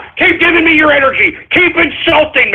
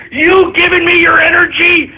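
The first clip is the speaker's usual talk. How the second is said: usual speaking voice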